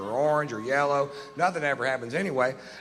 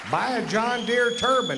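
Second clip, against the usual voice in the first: slow shout; 11 semitones higher than usual. Acted. Comic rant peak, shouting.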